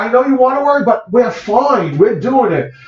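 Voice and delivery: high, bright reassuring brush-off to family; upbeat brush-off. Retold or imitated. Telling his family not to worry, high and cheery. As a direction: insist all is fine in a lifted voice. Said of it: "I know I used to always say".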